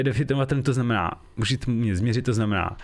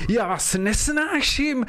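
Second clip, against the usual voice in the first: high, slow, hammed-up outburst; mock hatred; 11 semitones higher, much slower than usual. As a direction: spit it out in fake fury. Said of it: "pojďme si to zahrát".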